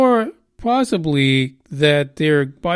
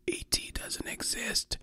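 hushed secret-telling whisper; 17 dB quieter than usual. Pretend. Podcaster whispering the articles' denial, hushed whisper. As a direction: breathe it like a conspiracy secret. Mocking skeptical articles' claim.

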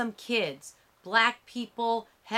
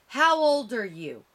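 higher, louder hostile question; menacing demand; 5 semitones higher, 6 dB louder than usual. A narrator's reading. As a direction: interrogate him, threateningly. Armed man interrogating uncle.

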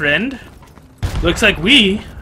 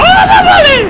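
much higher and louder, slowed, "my" stretched out; excited cry; 5 dB louder, slower than usual. Real, not acted. Gamer shouting to his buddy in the match, high excited cry.

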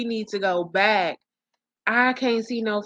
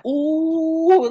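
usual speaking voice

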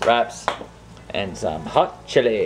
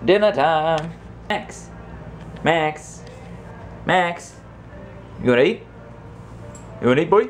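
calling the dog; 6 semitones higher than usual. Real, not acted. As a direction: call him in for dinner. Calling dogs for dinner.

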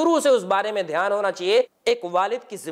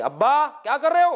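imitating a son scolding his father; mock scolding; 7 semitones higher than usual. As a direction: snap at him like a rude son. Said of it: "ڈانٹ کر نہیں".

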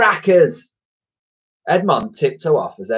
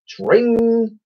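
high, ringing shop bell; bright sustained ding; bell imitation; 12 semitones higher than usual. A narrator's reading. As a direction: trill it like a door bell. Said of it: "The bell chirped".

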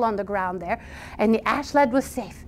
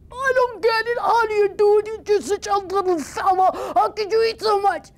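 high, baffled troll; amazed troll; 11 semitones higher than usual. Acted. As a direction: marvel at the little fella.

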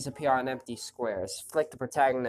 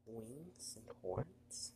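slow, very soft question; hushed wonder; 18 dB quieter, much slower than usual. Acted. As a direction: breathe it in quiet amazement. Wondering aloud in character.

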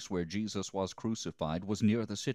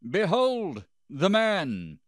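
high, loud and very slow; grand proclamation; 12 semitones higher, 9 dB louder, slower than usual. A narrator's reading. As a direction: present him to the crowd with a drawn-out call. Pilate presents Jesus to the crowd.

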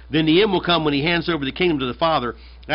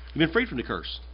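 "freed" still stressed but softer, let it settle; softer echo; 7 dB quieter than usual. Shown as a demonstration. Preacher repeating the promise to the congregation, softer echo. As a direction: repeat it quietly for it to sink in. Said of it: "repeats the line".

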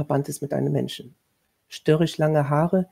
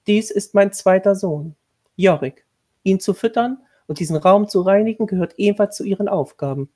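higher, louder, formal commanding tone; stern lady of the house; 5 semitones higher, 6 dB louder than usual. A narrator's reading. Lady of the house instructing the new governess, stern and formal. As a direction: lay down the duties like a strict mistress.

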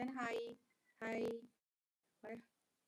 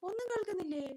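dismayed query; 8 semitones higher, 5 dB louder, faster than usual. Real, not acted. Asking again if nothing is heard.

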